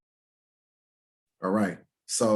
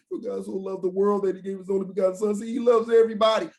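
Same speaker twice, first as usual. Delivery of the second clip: fast, higher, sing-song mock quote; 8 semitones higher, faster than usual. Preacher mocking how Christians quote the verse. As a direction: parrot the Christians. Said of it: "If he loves everybody".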